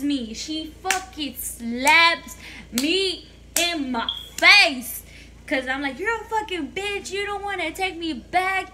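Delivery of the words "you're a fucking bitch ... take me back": high-pitched mock tantrum; retold angry outburst. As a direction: imitate a bratty kid accusing mom. Retold insult to mother, repeated.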